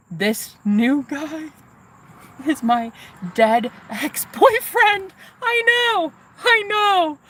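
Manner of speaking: high, slow, laughing disbelief; excited disbelief. Real, not acted. Telling viewers the big reveal, high giddy laughter. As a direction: stretch it out, giddy and stunned.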